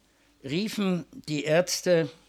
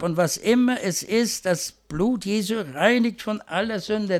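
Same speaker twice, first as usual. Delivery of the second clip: raised, loud fervent preaching; swelling pulpit fervour, louder and higher; preaching at full force; 5 semitones higher, 5 dB louder than usual. Acted. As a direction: proclaim it like a revival preacher. Preaching climax on grace.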